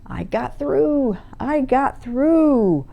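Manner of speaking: high, slow gloating of a possessing spirit; lightly voiced fiend crowing over his victory; fiendish laughing voice. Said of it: "Fred, with a fiendish laughing voice".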